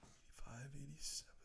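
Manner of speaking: real whisper, thinking aloud; counting to himself. Real, not acted. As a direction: work out the year under your breath. Working out the year to himself.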